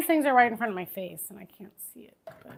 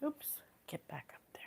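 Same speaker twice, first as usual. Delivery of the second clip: soft whisper to the food; 4 semitones lower, 18 dB quieter than usual. Real, not acted. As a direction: coax it back in a whisper. Whispered to slipping topping.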